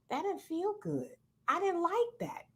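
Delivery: a quiet, deflated mutter; dejected. Retold or imitated. Someone shamed after a harsh talk, quietly dejected. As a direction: voice the hurt after a scolding, softly. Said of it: "you're dejected".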